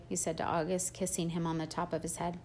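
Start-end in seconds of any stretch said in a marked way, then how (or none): none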